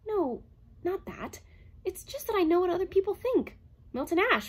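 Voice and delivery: a machine gently revealing; robot voice. A narrator's reading. Herbie hinting to Calvin in a high robot voice.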